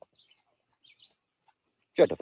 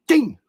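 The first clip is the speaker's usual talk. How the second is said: high, loud "ding" sound effect; imitated ding; 12 semitones higher, 8 dB louder than usual. Telling of busted front teeth, high imitated ding.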